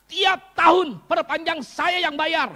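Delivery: high, slow shout. Acted. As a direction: slam each word out to the crowd. Climax of blessing list. (teriakan lambat dan tinggi)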